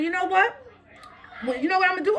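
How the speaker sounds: high, rising challenge; indignant set-up for a threat; retold confrontation. Retold threat to office staff.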